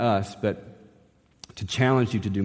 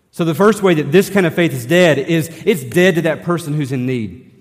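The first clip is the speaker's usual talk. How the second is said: louder, higher preaching swell; full-force preaching; 5 semitones higher, 8 dB louder than usual. Acted. Pastor pressing the congregation to act, full-force preaching. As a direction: drive the point home from the pulpit.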